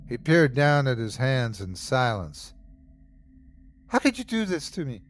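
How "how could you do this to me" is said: higher, fast anguished plea; betrayed plea to the stranger; anguished cry. A narrator's reading. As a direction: cry it out at him, hurt. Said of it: "I cried".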